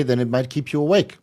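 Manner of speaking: ordinary talk, nothing marked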